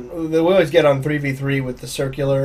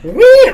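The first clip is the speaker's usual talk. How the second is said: high squeaky whee; 11 dB louder than usual. Pretend. Imitating radio noisemaker.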